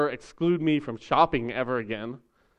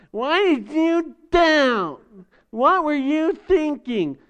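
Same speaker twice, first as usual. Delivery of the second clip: much higher, slow scolding grown-up; stern authority figure; 11 semitones higher, much slower than usual. Pretend. Jesus scolding Peter like a stern parent, high and slow. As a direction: scold like a parent to a twelve-year-old. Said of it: "like every authority figure when you're twelve".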